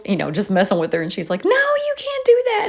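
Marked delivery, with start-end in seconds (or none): 1.4-2.6 high, whiny mom imitation; mom protesting. Mom objecting to giving away birds, high whiny imitation. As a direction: pitch it up like a fussing mother.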